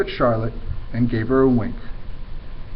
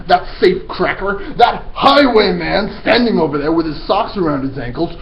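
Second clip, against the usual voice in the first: ranting headmistress; 7 semitones higher, 6 dB louder than usual. Acted. Trunchbull denouncing the boy to the whole school. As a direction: spit out every insult with disgust.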